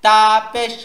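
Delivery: slow spelling chant, lingering on the first "তা"; slow, level letter-by-letter recitation; drawn-out spelling. Shown as a demonstration. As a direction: stretch "তা জবর", then land on the short syllable. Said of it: "বানানোর সময় ঝুল দিব".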